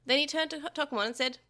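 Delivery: ordinary delivery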